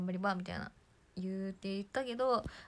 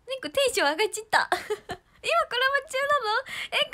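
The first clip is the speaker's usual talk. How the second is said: high, loud, laughing squeals; hyped up; 12 dB louder than usual. Real, not acted. Squealing about the collab with the fans. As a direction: let the excitement spill over.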